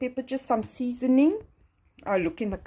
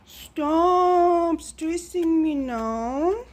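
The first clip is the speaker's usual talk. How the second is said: mock stressed whine; 6 semitones higher, 4 dB louder, much slower than usual. Real, not acted.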